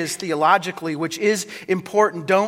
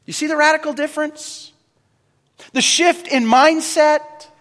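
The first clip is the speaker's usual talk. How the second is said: ordinary delivery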